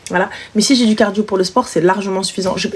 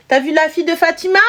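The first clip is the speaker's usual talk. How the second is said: mother's question; 7 semitones higher than usual. Retold or imitated. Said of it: "Donc quand elle va dire".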